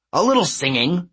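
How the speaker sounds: lightly voiced gruff satyr; a hint of a brash goat man; gruff satyr coach. A narrator's reading. Hedge boasts.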